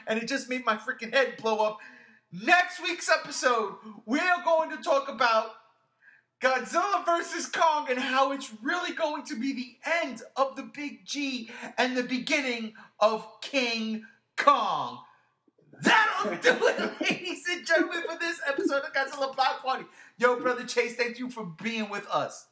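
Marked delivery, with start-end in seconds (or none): 2.3-15.0 higher-pitched, drawn-out show teaser; hype announcer. Acted. Host teasing next week's episode to listeners, hype announcer. 15.8-19.9 high-pitched announcer outro with laughter; showy sign-off. Acted. Host closing the podcast for listeners, showy announcer sign-off.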